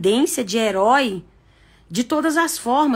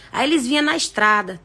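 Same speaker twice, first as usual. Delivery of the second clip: usual speaking voice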